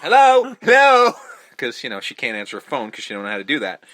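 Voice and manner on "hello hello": very high, loud sing-song "Hello, hello"; shrill mock hello. Re-doing the girl's phone greeting, much higher and louder. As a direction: squeal the greeting like a flustered girl. Mimicking the girl answering the phone.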